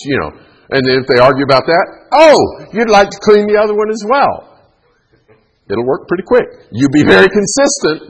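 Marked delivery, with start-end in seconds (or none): 2.1-4.3 high-pitched parent's comeback, playing pleased; mock delighted. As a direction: pounce on it with exaggerated delight. Parent's comeback to an arguing child.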